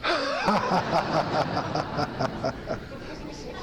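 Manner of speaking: long group-style belly laugh; laughter exercise. Shown as a demonstration. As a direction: let the laugh roll out. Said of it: "Take a deep breath".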